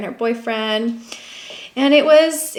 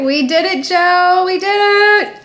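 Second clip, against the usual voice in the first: excited; 7 semitones higher, 5 dB louder than usual. Real, not acted. Celebrating success.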